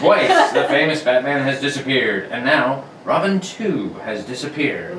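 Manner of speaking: put-on old-time radio narration; radio announcer. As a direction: narrate like a 1940s serial.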